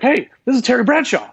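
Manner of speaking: Terry on the phone introducing himself, high and loud; Terry Bradshaw voice. Retold or imitated. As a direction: boom it out like a star quarterback announcing himself. Retold phone call, Terry voiced.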